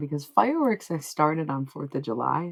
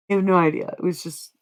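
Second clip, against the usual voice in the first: ordinary talk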